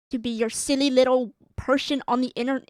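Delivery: ordinary talk, nothing marked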